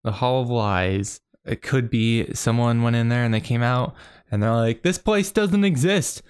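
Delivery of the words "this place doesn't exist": high, slow mock adventurer insisting. Adventurer stepping out of the Hall of Lies, in a high slow mock voice. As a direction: pitch it way up and drag it out, mock earnest. Patron claims dungeon doesn't exist.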